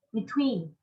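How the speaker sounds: "between" with TWEEN prominent and falling; stress on second syllable. Shown as a demonstration. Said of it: "two, between".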